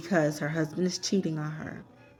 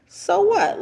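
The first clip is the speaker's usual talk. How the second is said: dismissive; 14 semitones higher, 7 dB louder than usual. Retold or imitated. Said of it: "Laura was like".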